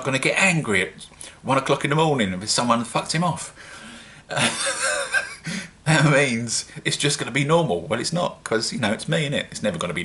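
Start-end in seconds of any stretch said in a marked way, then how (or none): 4.3-5.8 high-pitched giggle; high, tickled chuckle; amused laugh. Real, not acted. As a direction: laugh at your own joke. Laughs at his own joke.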